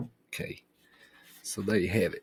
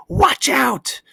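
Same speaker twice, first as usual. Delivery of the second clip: put-on loud, very high boy's yell; yelled warning; 7 dB louder than usual. A narrator's reading. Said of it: "and then he yelled".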